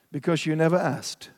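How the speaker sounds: friend's unbothered, slightly smug answer; cool, calm, unflustered. Retold or imitated. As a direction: shrug it off with calm nonchalance. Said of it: "in his cool, calm, unflustered, annoying way".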